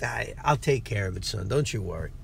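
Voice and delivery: a dad calmly covering the bill; reassuring dad. Retold or imitated. Father telling his son not to worry, soft and low reassurance. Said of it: "the wonderful father that he is".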